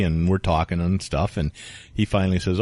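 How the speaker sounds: ordinary talk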